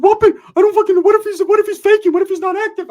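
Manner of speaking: very high, loud squawking protest; Ricky. Retold or imitated. Friend doubts the youngster is active, high loud mock alarm. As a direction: panicky comic falsetto. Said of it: "Ricky's like".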